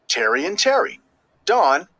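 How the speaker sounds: usual speaking voice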